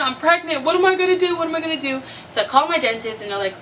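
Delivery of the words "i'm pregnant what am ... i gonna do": high-pitched, fretting re-enactment; mock panic. Pregnant woman facing dental work, mock panic. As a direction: act out a worried spiral, pitched up. Acts out panic over tooth pain.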